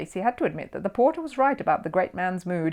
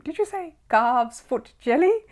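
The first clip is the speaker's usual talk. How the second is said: incredulous; 9 semitones higher, 3 dB louder, slower than usual. A narrator's reading. Sir Archibald incredulous.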